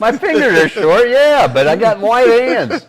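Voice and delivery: loud, high, laughing defence; playful protest. Real, not acted. Dad answering teasing about short fingers, playfully protesting. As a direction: defend yourself loudly through the laughter.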